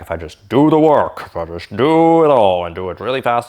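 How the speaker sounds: mock gung-ho self-urging; emphatic former mindset. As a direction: push hard on each DO, stretched out. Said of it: "I used to think, Okay".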